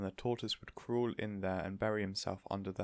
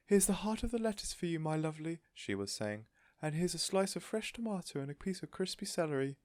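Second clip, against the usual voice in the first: high, sweet cooing voice; doting lady; 9 semitones higher than usual. A narrator's reading. Missus Silver feeding her tortoise, cooing fondly. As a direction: coo over a beloved pet.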